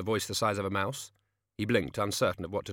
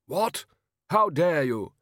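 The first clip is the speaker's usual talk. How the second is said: higher, louder affronted outburst; indignant king; 7 semitones higher, 6 dB louder, slower than usual. A narrator's reading. Interrupted king answering back to the intruder, indignantly. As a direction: take royal offence, pitch jumping up.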